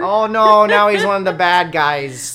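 loud, high Yooper alarm; hammed-up Yooper hand-wringing; Yooper accent. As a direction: cry out in mock Yooper dismay. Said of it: "fits really well into the Yooper thing".

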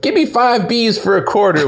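put-on high, laughing old man voice; rambling grandpa, cracking up; Grandpa Simpson voice. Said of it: "the Grandpa Simpson thing".

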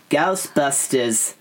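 drawn-out receptionist answering call; slow, put-on receptionist's phone greeting; Ghostbusters receptionist impression. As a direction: stretch the word out like the film's receptionist. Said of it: "the one who's like".

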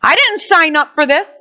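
mock outburst, starting high and loud; indignant quitter. Spurred believer quits, high mock indignant outburst. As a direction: announce you're quitting, hammed-up. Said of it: "you're like".

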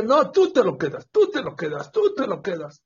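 high-pitched acted quarrel shout; mock shouting. Old couple yelling at each other over the coat, mock shouting. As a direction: hurl it back and forth in a mock row. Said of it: "Gritándose".

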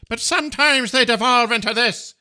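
high, squeaky mock Scottish babble ending in a laugh; mock Scottish girl. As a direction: mimic a Scottish girl spiralling into gibberish. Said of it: "The Irish are close".

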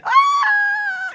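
high, loud panicked scream; inner scream. Retold or imitated. His panicking brain among the sharks, loud high scream. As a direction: let out a shrill terrified shriek. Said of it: "It was screaming".

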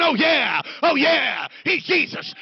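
high, loud hammed-up bragging; boastful shouting. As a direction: play a disciple shouting off his famous friend. Disciples bragging as they walk in.

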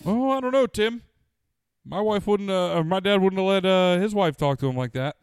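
high, loud hammed-up old-school dad; mock macho dad. Answering his TV wife as a blustering father. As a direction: ham up a blustering tough-guy father. Mocking tough sitcom dad voice.

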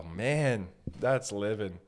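ordinary talk, nothing marked

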